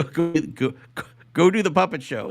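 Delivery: normal talking voice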